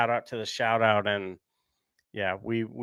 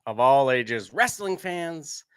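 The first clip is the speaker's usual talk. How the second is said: ringmaster; 4 semitones higher, 8 dB louder than usual. Pretend. Podcast opening announcer intro.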